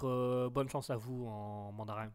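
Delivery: ordinary talk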